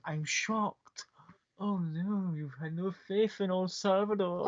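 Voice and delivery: hammed-up hurt gasp, high and breathy; feigned shock. Salvador teasing the doubting player with mock shock. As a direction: play the wounded showman, arched and theatrical. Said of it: "almost feigning in the arch and goes".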